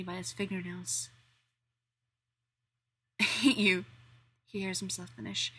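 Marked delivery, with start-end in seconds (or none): 3.2-3.8 loud, strained I hate you; strained anger. Acted. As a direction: bite it out, overwhelmed. Danny cursing Steve, overwhelmed.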